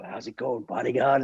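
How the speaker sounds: lightly voiced film star, soft greeting; Clint Eastwood. Film star's daily hello to the bodyguard, soft impression. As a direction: greet him quietly as the movie legend. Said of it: "and in, in that accent".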